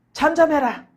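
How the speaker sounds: loud, forceful order to be silent; acted crowd's sharp telling-off; rebuking. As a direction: scold him loudly into silence. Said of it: "군중들이 막 꾸짖습니다".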